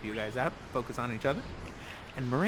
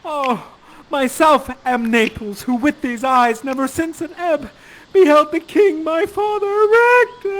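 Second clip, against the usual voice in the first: loud, high theatrical lament, drawn out; Ferdinand crying out; 9 semitones higher, 9 dB louder, slower than usual. Acted. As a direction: cry out slowly, rising toward anguish. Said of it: "You cry out".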